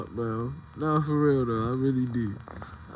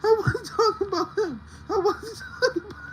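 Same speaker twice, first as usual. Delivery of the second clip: mock crying; 12 semitones higher, faster than usual. Pleading, fake crying.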